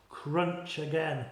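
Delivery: plain reading voice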